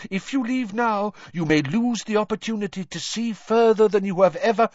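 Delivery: high, urgent professor voice; insistent professor. A narrator's reading. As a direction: press them to stay, pitched high.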